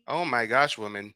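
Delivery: ordinary talk, nothing marked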